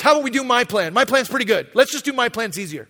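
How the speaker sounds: loud insistent pitch. Acted. Abram pressing God loudly for his own plan, insistent. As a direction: sell it loudly like a stubborn kid.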